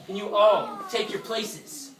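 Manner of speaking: ordinary delivery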